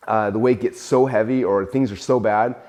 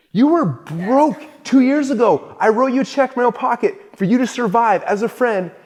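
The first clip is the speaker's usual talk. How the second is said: indignant; 12 semitones higher, 4 dB louder than usual. Retold or imitated. Founder retelling what he'd say to the friend who attacked him, incredulous and indignant. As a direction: throw the betrayal back at him.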